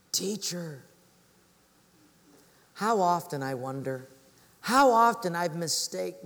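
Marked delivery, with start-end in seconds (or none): none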